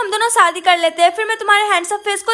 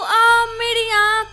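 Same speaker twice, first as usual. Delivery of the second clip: ordinary delivery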